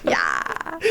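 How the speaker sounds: low gravelly vocal fry. Shown as a demonstration. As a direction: drop into a deep fry. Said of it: "You're the vocal fry".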